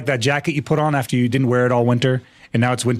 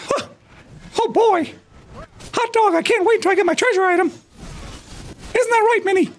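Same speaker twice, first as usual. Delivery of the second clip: very high cartoon falsetto; squeaky mouse voice, gleeful; high-pitched Mickey falsetto talking to Minnie; Mickey Mouse impression. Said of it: "my Mickey Mouse".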